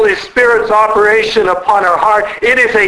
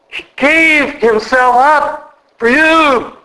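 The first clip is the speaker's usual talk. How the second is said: impassioned preaching; 4 semitones higher than usual. Real, not acted. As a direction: build an earnest plea, then stretch out the last line. Impassioned appeal on Christ's sacrifice.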